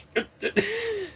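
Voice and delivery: high squeaky laugh. Real, not acted. Laugh after the panic.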